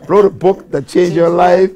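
who, me? said slow and loud; incredulous. Retold or imitated. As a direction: stretch it out, amazed. Disbelief at teacher's words.

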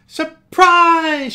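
high squealed, loud surprise; excited cry. Acted. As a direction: burst out with delight as she hugs him. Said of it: "she cried".

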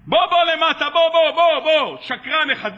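acted irate customer yelling; angry summons. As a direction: demand he come down at once. Angry customer orders vendor down.

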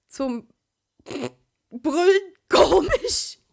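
high, loud, slow words squeezed out between laughs; gasping through laughter. Acted. Sister laughing at Sasuke in a skirt, words forced out high and loud. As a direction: barely get it out for laughing. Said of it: "bekam ich nur mit Mühe raus".

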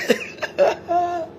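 high-pitched burst of laughter. Real, not acted. Streamer laughing at her rival, high laugh. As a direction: laugh out high after the curse.

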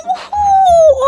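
loud high splash whoop. Acted. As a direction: whoop as you hit the water. Splashes into water. (yüksek sevinç çığlığı)

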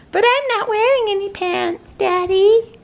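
put-on very high little-kid voice; baby's pretend voice. Parent answering Daddy for the baby, pretend baby voice.

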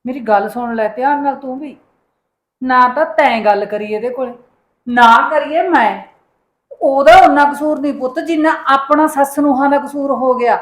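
loud, firm scolding, slowing to stress points; loud lecture. Acted. Mother-in-law scolding her daughter-in-law, loud and firm. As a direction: lay down the law, loud and deliberate.

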